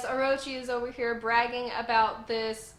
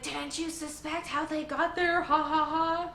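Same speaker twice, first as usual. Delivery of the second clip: mocking villain gloat; 6 semitones higher than usual. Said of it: "He's like".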